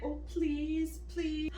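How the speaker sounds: high, pleading mock voice of Ryle; mock begging. Vlogger mimicking Ryle groveling, high pleading mock voice. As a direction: whine please please like a groveling man. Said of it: "He's like".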